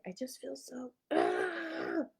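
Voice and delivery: strangled, wordless frustrated cry; mock cave woman groan of frustration. Reliving her journaling moment as an inarticulate cave woman cry. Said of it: "I'm cave womaning".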